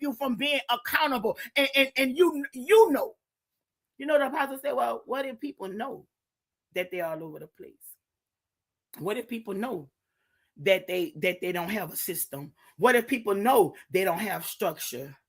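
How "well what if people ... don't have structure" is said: hushed quote. Retold or imitated. Apostle quoted, hushed worried voice.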